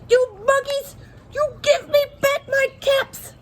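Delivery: furious peddler at the end of his rope, yelling; very angry shout. Acted. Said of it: "the peddler was very, very angry".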